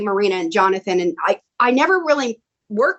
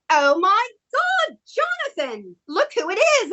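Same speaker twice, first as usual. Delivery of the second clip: lightly imitated Marina's accent, high and excited; 9 semitones higher than usual. Marina spotting Tracy in the crowd, in Marina's accent. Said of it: "you do her accent really well too".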